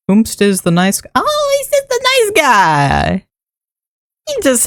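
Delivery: long high coo over the nice guy. Real, not acted. Cooing at pet.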